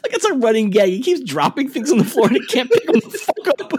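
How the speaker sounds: high, giggling through the words; real cracked-up, high-pitched delivery; laughing. Real, not acted. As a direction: barely hold back the laughter. Cracking up over running gag.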